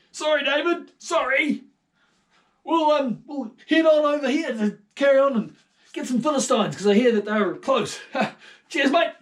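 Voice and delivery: high loud offhand king; breezy Saul. Acted. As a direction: brush it off cheerily and leave.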